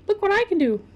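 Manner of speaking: high man-child impression; Stewart. Co-host quoting a TV sketch man-child, high childish impression. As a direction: pipe it up high like an overgrown child. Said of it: "With Stewart, the, the really pale man-child".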